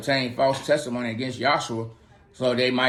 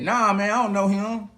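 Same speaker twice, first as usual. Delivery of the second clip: higher, casual dismissive denial; Peter brushing it off; 7 semitones higher than usual. Acted. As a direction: wave it off like it's nothing. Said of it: "And he said".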